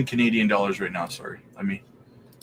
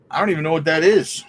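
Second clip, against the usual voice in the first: louder and faster, exasperated; louder, quicker, baffled; emphatic repeat; 4 semitones higher, 5 dB louder, faster than usual. Real, not acted. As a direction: blurt it out again with more force. Repeats confused reaction.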